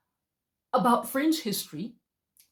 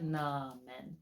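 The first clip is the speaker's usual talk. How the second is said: muttered disbelief; 6 semitones lower, 11 dB quieter than usual. Retold or imitated. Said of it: "I was just like".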